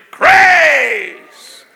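high, loud, each Grace drawn out; shouted proclamation. Acted. Preacher declaring Grace to the congregation, shouted high. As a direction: proclaim Grace at the top of your voice. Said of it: "when he says what's been given him".

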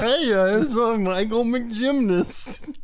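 high, slow goofy bad impression; bad Nigel impression. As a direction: ham up a terrible Nigel. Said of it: "a bad illustration of Nigel McGuinness".

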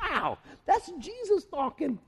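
ordinary talk

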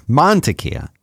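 "Mantequilla" said MAN-tequilla; first-syllable stress. Shown as a demonstration. Co-host answering with his rule, first-syllable stress on "Mantequilla". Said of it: "emphasis on the first syllable".

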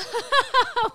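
loud high laugh; relieved laugh after the story. Real, not acted.

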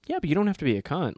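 quick, snappy comeback; rapid sarcastic retort; sassy retort. Retold or imitated. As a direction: fire it back fast. Retold retort to rude people.

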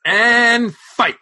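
high, loud announcer-style shout; playful, high-pitched battle call; mock fight call. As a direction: shout the start of a mock bout.

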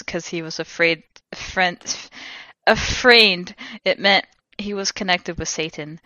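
ordinary talk, nothing marked

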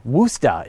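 Worcester said as WOOS-tah, final r dropped; local pronunciation. Shown as a demonstration. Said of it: "if you come from there".

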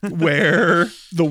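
ordinary delivery

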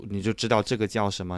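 ordinary talk, nothing marked

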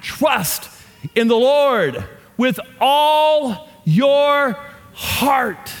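very slow, high, stretched-out proclamation; emotional reading. Shown as a demonstration. Preacher demonstrating that scripture can't be read without emotion, impassioned. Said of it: "you can't read it without being emotional".

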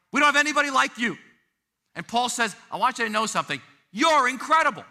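loud, high-pitched proclamation with punched key phrases; emphatic preaching. Real, not acted. Pastor telling the congregation they are unique, emphatic preaching. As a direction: preach it with full conviction, pitch lifted.

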